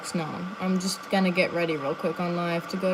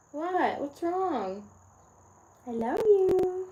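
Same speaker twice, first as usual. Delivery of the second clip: high, slow doting coo; 10 semitones higher, much slower than usual. Real, not acted. Comforting her listless dog, high doting baby talk. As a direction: melt over the puppy.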